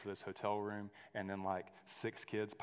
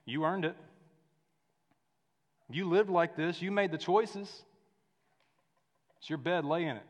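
a touch louder, a lightly voiced stern condemnation; mock harsh judgment; 6 semitones higher, 6 dB louder than usual. Preacher voicing the judgment Jesus never spoke, mock stern. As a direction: hand down the verdict coldly, half-acted. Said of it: "He didn't say".

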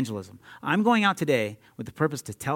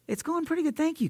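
much higher, soft grateful voice; lady at drive-thru; 13 semitones higher, 4 dB quieter than usual. Retold or imitated. Drive-thru lady thanking the preacher, high and soft. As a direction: play the surprised, touched cashier. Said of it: "And the lady's like".

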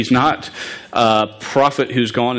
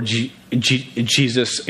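slow stuttered start on Jesus; real stutter; 3 dB quieter, slower than usual. Real, not acted. Preacher giving the crowd Pilate's choice, stuttering. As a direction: repeat the first sound before the name comes. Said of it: "A st-, stuttering guy".

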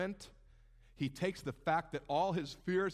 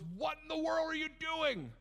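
despairing Moses; 10 semitones higher than usual. Acted. Moses comes to God in his despair, high exasperated cry. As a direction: act out baffled despair with the pitch shooting up. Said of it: "in his disappointment, his despair".

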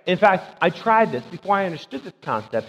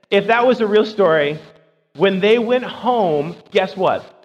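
louder, higher, slowing hard on "when they went home"; emphatic preaching; 3 semitones higher, 7 dB louder, slower than usual. Real, not acted. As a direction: drive the point home to the congregation. Preacher presses his point.

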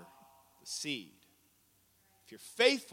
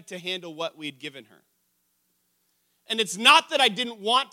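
loud, raised-pitch sermon delivery; impassioned preaching; 7 semitones higher, 10 dB louder than usual. Real, not acted. Preacher telling the congregation about his daughter's dollar, impassioned. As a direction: preach it out with force.

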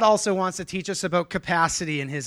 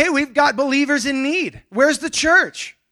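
raised, pleading Paul calling for the church; voicing Paul; 8 semitones higher, 5 dB louder, slower than usual. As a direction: call out as Paul, louder and higher. Paul voiced calling for givers.

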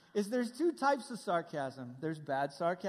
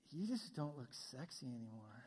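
low, hushed deadpan tease; sarcastic teasing; 8 semitones lower, 13 dB quieter than usual. Retold or imitated. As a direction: tease a partner quietly, pitched well down.

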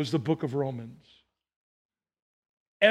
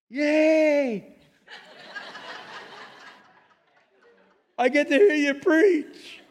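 high, bright, slow cheer; excited God voice; 10 semitones higher, much slower than usual. Retold or imitated. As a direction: squeal with delight like a child. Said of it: "it sounded like this".

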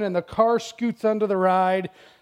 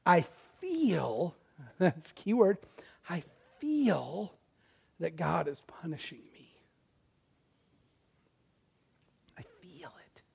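fading, softer and softer to a whisper; despairing whisper; 3 semitones lower, 9 dB quieter than usual. Retold or imitated. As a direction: confess it under the breath. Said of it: "and he goes".

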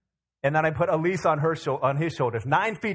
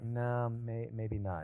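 much softer, shrinking back; timid mumble; 4 semitones lower, 10 dB quieter, much slower than usual. Retold or imitated. Frightened Israelites answering the giant's challenge, very soft and timid. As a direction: say it like you hope nobody hears.

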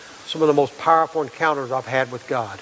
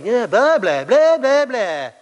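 mock blah blah; 9 semitones higher, 5 dB louder, slower than usual. Mocking Gideon's endless excuses.

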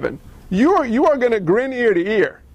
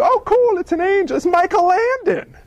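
mock casual people; 11 semitones higher than usual. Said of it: "what do the people do".

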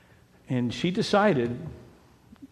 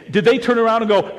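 ordinary talk

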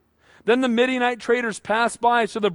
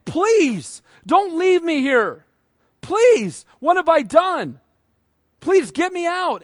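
higher, louder imitation of desperate begging; pleading cry; 6 semitones higher, 4 dB louder, slower than usual. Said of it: "he's crying out to his brothers".

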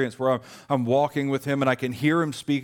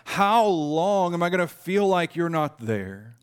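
higher, slower plea of abandonment; crying out; 5 semitones higher, slower than usual. Retold or imitated. Preacher voicing David asking God how long, pleading. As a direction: ask it like a lonely cry. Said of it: "David cries out to God".

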